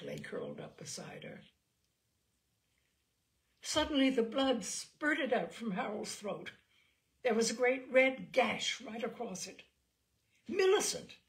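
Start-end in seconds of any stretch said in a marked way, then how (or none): 3.6-9.6 dramatic climax. Acted. Climax of murder account. 10.5-11.2 much higher, much louder cry; cried out. Acted. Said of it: "cried Kathleen".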